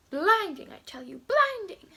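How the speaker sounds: loud, high, theatrical exclamation; mock-dramatic insistence. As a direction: proclaim it with over-the-top emphasis. Mock-dramatic insisting on blinding highlight.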